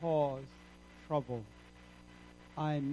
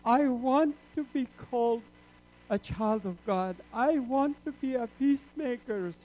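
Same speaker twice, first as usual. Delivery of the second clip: raised, pleading cry to the Lord; pleading prayer; 7 semitones higher, 6 dB louder than usual. Retold or imitated. Convert retelling his tearful prayer, pleading loudly. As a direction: beg the Lord to be his child.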